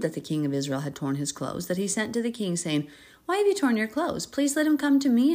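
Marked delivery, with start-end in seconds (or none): 3.3-5.3 high, loud summons; urgent call. Acted. A lightly voiced Elisha urging the king. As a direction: press the king to send him.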